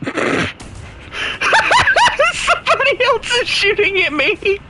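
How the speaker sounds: very high, squealing laugh-talk. Real, not acted. Player shot at by yet another enemy, laughing too hard. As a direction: squeeze the words through helpless laughter. Laughing while under fire in game.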